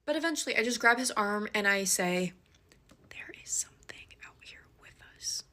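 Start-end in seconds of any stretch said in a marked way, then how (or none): 3.1-5.4 unvoiced urgent whisper. Whispering a warning to her friend in the dark woods. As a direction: whisper it so no one out there hears. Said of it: "I made sure to whisper it".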